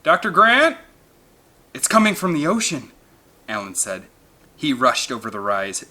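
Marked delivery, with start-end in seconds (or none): 0.0-0.8 loud, high call through a megaphone; bullhorn call. A narrator's reading. Man on the beach calling through a bullhorn, amplified. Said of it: "A voice on a bullhorn". 1.7-2.9 urgent. A narrator's reading. Alan hears distant call.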